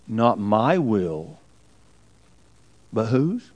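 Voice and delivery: a whispered-close prayer of surrender; yielding his will in agony, soft and slow; hushed, slow surrender. Acted. Jesus's prayer in Gethsemane.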